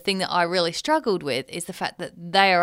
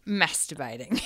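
laughing; 5 dB quieter than usual. Real, not acted. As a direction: giggle through the word. Giggling through an embarrassing word.

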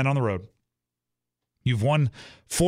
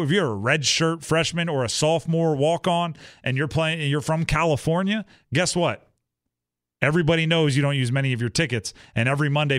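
ordinary delivery

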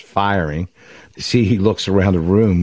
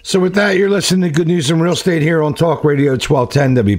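high, upbeat radio station ID; radyo anonsu; 8 semitones higher than usual. Real, not acted. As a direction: announce the station in a lifted, higher host voice.

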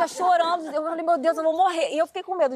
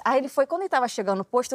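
ordinary delivery

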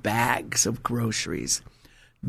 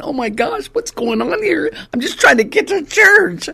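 much higher, rising to a loud squeal; mock panic at all the flat tires; frazzled exasperation; 6 dB louder than usual. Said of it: "I'm thinking".

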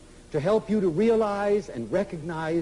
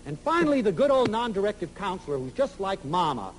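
normal talking voice

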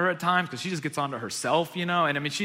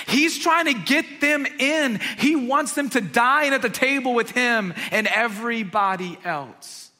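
loud, high passionate preaching; preaching at full force; 8 semitones higher, 5 dB louder than usual. Acted. Pastor urges the congregation about grace, preaching loud and fervent. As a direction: build to a fervent climax.

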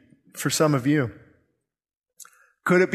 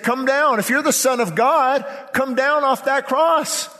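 high, emphatic jeer from the crowd; preacher imitating scoffers daring a condemned man; mocking taunt; 8 semitones higher than usual. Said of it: "who the mocking crowd was".

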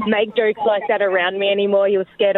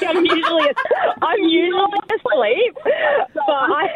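laughing; 8 semitones higher than usual. Real, not acted. Laughing while answering question.

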